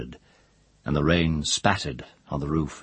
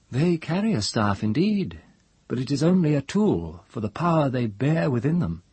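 higher-pitched, earnest character voice; raised pitch, measured correction; Thar in dialogue; 8 semitones higher than usual. A narrator's reading. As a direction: explain it as a storybook elder, pitched up.

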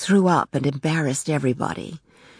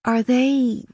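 faltering question, pitched higher; unsure, halting wife; weak, hesitant; 7 semitones higher, slower than usual. A narrator's reading. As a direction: stumble uneasily into the question. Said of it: "she asked weakly".

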